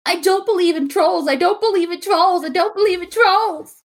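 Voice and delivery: high, whiny copy of the troll's voice; whiny troll mimic. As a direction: mimic him but whinier. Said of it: "in his voice, but a little bit whinier".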